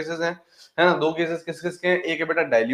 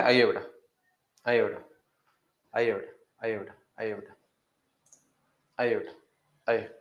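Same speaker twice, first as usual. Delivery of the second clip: quiet beckoning; 5 semitones lower, 5 dB quieter than usual. Real, not acted. Low repeated beckoning while waiting.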